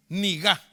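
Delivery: "Nigá" with the stress on the final A; nigá, stress on the last a. Shown as a demonstration. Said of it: "Le faltó el acento en la A". ("Nigá" con acento en la A final)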